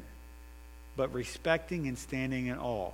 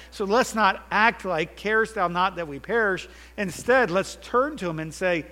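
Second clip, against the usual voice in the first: loud, high, driving sermon voice; full-force preaching; 4 semitones higher, 8 dB louder than usual. Acted. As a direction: preach it out to the whole room.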